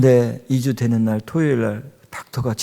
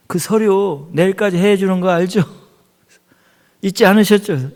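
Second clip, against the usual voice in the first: higher, loud voice of a nagging caller; mimicking a pushy phone reminder; insistent caller; 7 semitones higher, 6 dB louder than usual. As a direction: press the reminder on a busy pastor. Acts out demanding phone caller.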